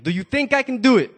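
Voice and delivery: rally the audience loudly; loud shout. Acted. Acrobat challenging the crowd, loud shout. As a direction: shout the question out high to the crowd. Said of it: "audience call prompt".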